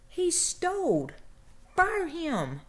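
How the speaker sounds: indignant demand. Retold or imitated. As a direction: drag out each word, outraged. Demanding boss fire thief.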